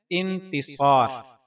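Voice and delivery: انتصار in three measured parts, long final aa; correct pronunciation. Shown as a demonstration. Teaching viewers the name's right pronunciation, slow careful demonstration. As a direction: say انتصار slowly as in-ti-saar. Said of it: "اس کا درست تلفظ ہے".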